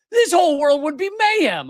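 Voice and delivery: high, exasperated outburst; worked-up. Real, not acted. Host warning of kidnapped presidents everywhere, worked-up.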